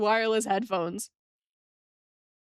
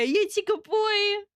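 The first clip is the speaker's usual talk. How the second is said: put-on "You're a good boy." drawn out and much higher; high pet praise; 10 semitones higher, slower than usual. Streamer cooing to a viewer as to a puppy, high and slow.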